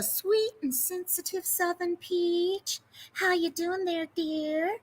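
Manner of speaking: light Southern drawl on How you doing there, dear; Southern accent. Pretend. Welcoming a chat member with a little Southern accent. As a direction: greet her like a sweet Southern lady. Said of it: "I can use my little Southern accent".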